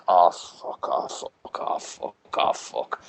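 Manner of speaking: slow, low, breathy whispered curses; despairing whisper. Retold or imitated. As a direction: mutter it in disbelief as the stadium goes silent. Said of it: "and I went".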